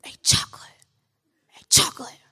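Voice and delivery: whispered hey Chocolate; hissed call. Acted. Catcaller hissing at a woman as she passes.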